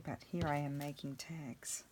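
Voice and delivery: whispered aside, all breath; wry whispered admission. Real, not acted. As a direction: confess it in a hushed whisper. Aside to camera while crafting.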